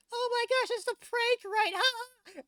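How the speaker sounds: put-on very high, squeaky kid voice; imitating a kid, pitched far up; confused kids. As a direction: play a baffled little kid thinking it's a prank. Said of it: "the kids are probably like".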